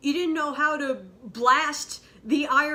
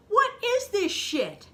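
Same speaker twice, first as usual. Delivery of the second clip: exasperated; 10 semitones higher, 4 dB louder, slower than usual. Real, not acted. Outraged disbelief continues.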